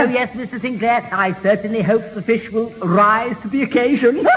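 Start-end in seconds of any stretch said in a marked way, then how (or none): none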